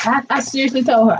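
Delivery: normal talking voice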